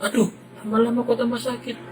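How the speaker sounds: put-on crying kid. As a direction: let the hurt boy break into a wail. Boy stung, pain growing.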